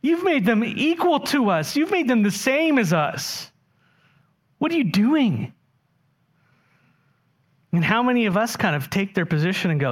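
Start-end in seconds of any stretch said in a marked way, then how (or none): 0.0-5.5 indignant workers' complaint. Acted. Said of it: "He says".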